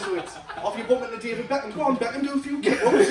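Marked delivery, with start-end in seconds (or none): 1.8-3.1 loud, higher, laughing urging; mock pushy fan calling out; egging on. Fan bumping into David Beckham, egging him on.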